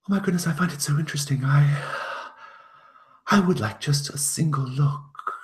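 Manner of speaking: high, fast, eager pleading; loud, high, gushing curiosity; unfamiliar woman's voice. Acted. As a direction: plead excitedly in a high-pitched voice. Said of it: "another woman's voice, one that you don't recognize".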